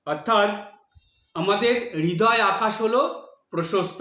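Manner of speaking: normal talking voice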